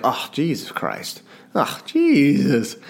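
slow, weary "Oh, Jesus Christ. Oh, Jesus."; slow exasperated muttering; drawn-out exasperated sigh. Shown as a demonstration. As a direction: let it drag out like a tired habit. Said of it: "And I'll say it like".

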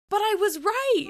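high, loud mock whine; retold plea of being right; indignant protest. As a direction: insist loudly, like a wronged player. Protesting the ruling.

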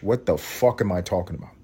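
ordinary delivery

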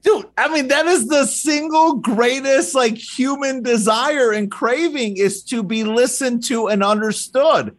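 fired up by the point, nearly shouting; excited agreement. Real, not acted. Host fired up, agreeing with the guest about being heard.

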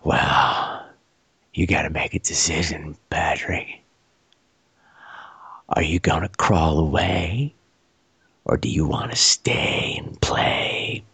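low, slow, creepy whisper; husky voice. Retold or imitated. Stranger questions his pinned victim, husky whisper. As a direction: murmur the questions deep and soft. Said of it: "there's this husky voice in my ear".

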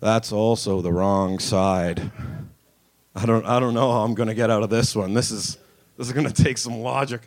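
put-on drunk, defeated. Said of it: "then you're like".

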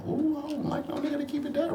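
high, mocking girly voice; mocking women's voice. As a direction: mimic a woman complaining, pitched high. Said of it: "women like".

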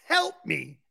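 lightly voiced weak plea for help; mock pleading like in the movie; Jerry Maguire plea. Pleading to teammates like the movie scene, lightly voiced. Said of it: "I sound like Jerry Maguire".

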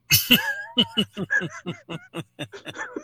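high-pitched burst of laughter. Real, not acted. Joke teller after the punchline, high real laughter. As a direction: laugh hard at your own punchline.

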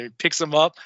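ordinary delivery

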